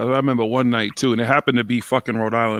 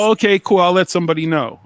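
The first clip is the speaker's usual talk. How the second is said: higher, louder mock clerk voice; front desk clerk; 7 semitones higher, 6 dB louder than usual. Hotel clerk brushing off a guest's complaint, mock chirpy. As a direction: imitate a breezy clerk, pitched up. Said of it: "talking to the girl, she's like".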